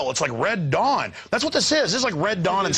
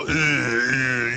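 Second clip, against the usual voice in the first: mock cop grunts; 4 semitones lower than usual. Cops imitated.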